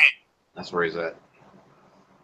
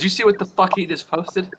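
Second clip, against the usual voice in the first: imitating Troy; 8 semitones higher than usual. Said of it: "he goes".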